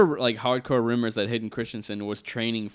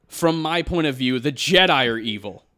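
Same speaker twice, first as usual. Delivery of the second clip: a touch louder, earnest line reading; serious acting take; 7 dB louder than usual. Shown as a demonstration. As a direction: deliver it dead serious. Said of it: "I'm gonna go into acting mode".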